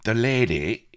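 high, loud, pained protest; hurt, squeaky grievance; pained complaint. A narrator's reading. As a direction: yelp it as if just hurt. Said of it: "grunted painfully".

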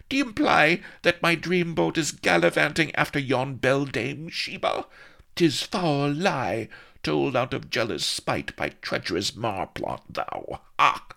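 high, melodramatic heroine outrage; indignant cry. A narrator's reading. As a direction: rail at him, starting high and loud. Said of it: "cried she".

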